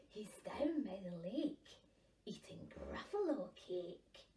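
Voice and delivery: secretive whispering snake; sly whispered snake. Acted. Snake answers the child.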